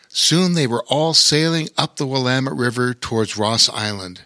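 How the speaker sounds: ordinary delivery, nothing marked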